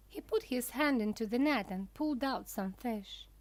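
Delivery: plain reading voice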